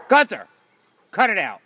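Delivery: high, loud reprimand; loud scolding. Real, not acted. Owner telling Gunter to cut it out, scolding loudly. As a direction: order the dog to quit it.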